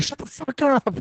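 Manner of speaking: high, put-on snap at a friend; mock-pissed comeback; annoyed retort. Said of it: "And I'm like".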